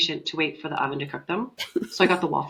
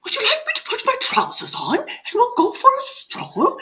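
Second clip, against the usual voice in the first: very high, hammed-up English accent; squeaky mock-English invitation, tickled by it; ridiculous English accent. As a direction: ask the dog in overdone English. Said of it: "a ridiculous English accent".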